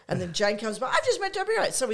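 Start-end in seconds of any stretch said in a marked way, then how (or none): 0.9-1.7 high, loud, gushing announcement; excited fan. Retold or imitated. Lou telling Jane as she comes by, excited. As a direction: imitate a fan repeating the big news. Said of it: "he's so excited, he's telling everyone".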